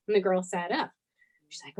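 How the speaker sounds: ordinary talk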